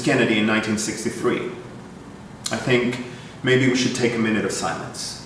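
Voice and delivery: low, subdued address; somber announcement. Acted. Host tells audience of the president's assassination, somber and low.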